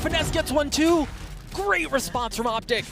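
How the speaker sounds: loud, high-energy call of the kill; excited esports caster. Real, not acted. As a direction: shout it out as the play lands. Caster erupts after a kill.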